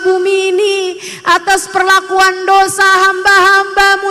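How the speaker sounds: loud, high, pleading cry to God; fervent prayer. Acted. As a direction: beg for mercy on the world at full voice. Saint's fervent night prayer acted. (doa memohon ampun yang lantang dan tinggi)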